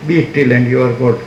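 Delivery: very slow, each word set apart; quoting Raman, slow and weighty; slow solemn quote. Retold or imitated. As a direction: deliver the sage's words slowly and gravely. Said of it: "અને રમણે કીધું ને".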